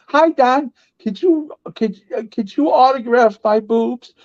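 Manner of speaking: high, sweet voice of a flirty woman asking a favor. Retold or imitated. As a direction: coo it up high like a fan asking a star. Woman asks Don for autograph.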